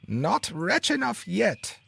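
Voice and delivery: very high, slow mocking bird voice; taunting sparrow. A narrator's reading. Sparrow mocking the unlucky carter, slow high taunt. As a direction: taunt him slowly from up high. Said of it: "said the sparrow".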